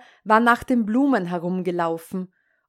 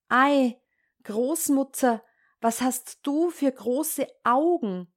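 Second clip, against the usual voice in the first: put-on higher, amazed child's question; 4 semitones higher than usual. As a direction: marvel at it like a puzzled girl.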